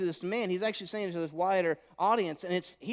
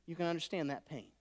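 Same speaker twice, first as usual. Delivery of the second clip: ordinary talk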